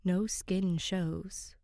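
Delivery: plain reading voice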